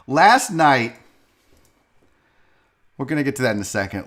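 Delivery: usual speaking voice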